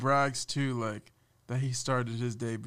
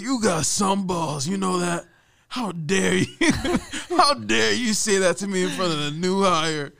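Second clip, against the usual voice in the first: mock outraged boss; 7 semitones higher, 9 dB louder than usual. Boss scolding employee over the roast, hammed-up loud outrage. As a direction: boom it out like an offended boss. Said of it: "he's like".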